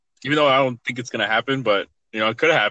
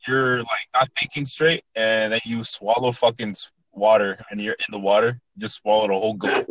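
usual speaking voice

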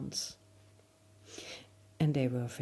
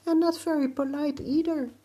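high, fast chirpy voice; high flower voice; 11 semitones higher, 5 dB louder, faster than usual. Acted. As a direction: squeak it out quickly. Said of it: "added the flowers".

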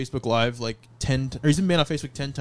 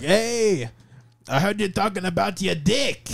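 put-on drunk uncle; 5 semitones higher, slower than usual. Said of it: "and he's like".

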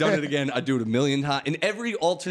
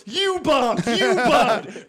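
high, loud taunting yell; mock heckling shout; 9 semitones higher, 5 dB louder, much slower than usual. Kid taunting each comic in turn, loud and high. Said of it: "goes through the comics and goes".

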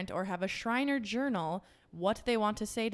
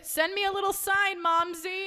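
high, loud mock plea; playful calling out; 12 semitones higher, 9 dB louder than usual. Asking dead mom for a sign, playful holler. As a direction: call out cheekily, pitched well up.

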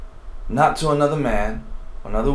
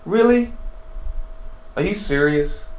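much higher, incredulous come-on-buddy tone; exasperated disbelief; 7 semitones higher than usual. Acted. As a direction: play it like a fed-up friend who can't believe it. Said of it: "It's a little frustrated".

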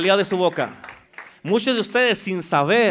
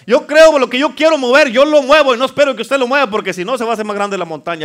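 loud, driving declaration; impassioned preaching; 5 semitones higher, 10 dB louder than usual. Real, not acted. Preacher declaring his own faith to move mountains, impassioned. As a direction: declare it forcefully, pressing on without a break.

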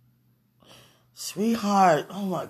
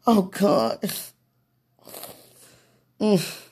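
ordinary delivery

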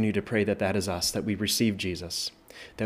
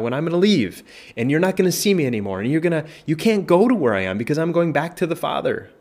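higher and louder, pressing warning; earnest warning; 7 semitones higher, 4 dB louder than usual. Acted. Preacher as Jesus warning those rejecting him, raised and earnest. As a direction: urge them, voice lifted.

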